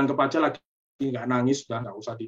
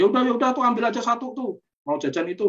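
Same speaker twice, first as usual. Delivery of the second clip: giving in; 8 semitones higher, faster than usual. Retold or imitated. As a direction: throw up your hands and give in to the child. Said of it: "Ya, misalkan".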